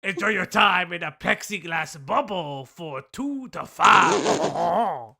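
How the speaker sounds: loud, indignant mock French; French accent. Reads insults from a post in a loud French accent. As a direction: rant in a hammed-up French accent. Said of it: "my French accent".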